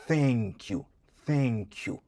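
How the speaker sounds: tongue out, air pushed for "thank you"; th model for the class; careful th. Shown as a demonstration. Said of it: "n ap pouse lè soti de yo".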